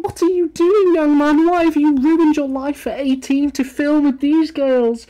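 put-on high, loud scolding of the young man; mock scolding parent. Streamer scolding the lad in the video in a high mock voice. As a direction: tell him off like a shocked mum.

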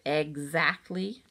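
exactly stretched slowly, each syllable weighed; stressed exactly. Acted. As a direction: lean on exactly, drawn out. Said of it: "she said the word".

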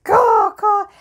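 high, loud crow squawks; noisy crows. Pretend. Crows always talking to each other, noisy squawking. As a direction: caw like chattering birds. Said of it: "They're always talking to each other".

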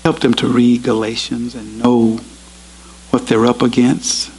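measured, earnest prayer voice; closing prayer. Acted. Preacher prays aloud for his brothers and sisters, earnest. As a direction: lead the congregation in prayer.

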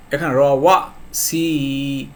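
র ওয়া সি drawn out slowly, syllable by syllable; slow recitation. Shown as a demonstration. Teacher showing students the letters, slow recital. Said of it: "আবার দেখেন".

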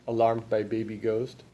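ordinary delivery, nothing marked

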